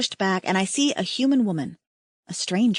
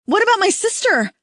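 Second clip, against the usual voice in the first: much higher, loud pleading cry; desperate cry; 11 semitones higher, 5 dB louder than usual. A narrator's reading. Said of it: "the woman cries".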